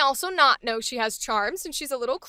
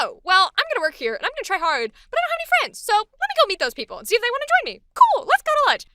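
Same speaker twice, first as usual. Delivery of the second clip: high-pitched, fast, perky chatter; eager new worker; 8 semitones higher, 5 dB louder, much faster than usual. Acted. Newcomer with no friends seeking lunch company, eager. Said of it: "She's genuinely this person who shows up".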